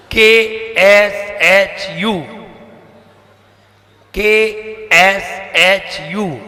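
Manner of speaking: के एस एच यू spelled slowly letter by letter. Shown as a demonstration. Teacher spells the sounds of चक्षु slowly. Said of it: "क्या बोलेंगे?".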